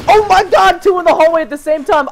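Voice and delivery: high, loud panicked outburst; excited alarm. Real, not acted. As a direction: yell the surprise at your partner. Two enemies appear at once.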